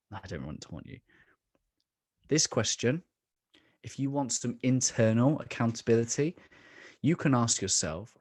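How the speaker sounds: ordinary talk, nothing marked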